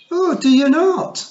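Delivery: high, loud, slow sharp retort; sharply. Acted. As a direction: snap it back with scorn. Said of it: "Fionn sharply".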